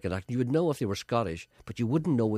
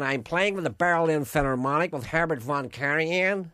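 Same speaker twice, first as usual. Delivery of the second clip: higher, lilting impression of a flute player; raised, showy musician's voice; 5 semitones higher, 5 dB louder than usual. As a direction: mimic a much-travelled musician's put-on accent. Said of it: "[name] talks like that".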